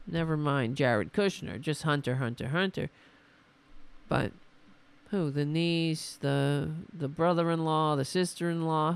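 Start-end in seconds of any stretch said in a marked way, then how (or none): none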